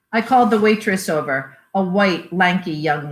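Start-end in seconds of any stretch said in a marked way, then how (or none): none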